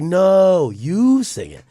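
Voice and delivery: imitated friend insisting, stretching NO and YOU; playful insistence. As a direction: throw it back with a swooping "you". Other side of retold argument.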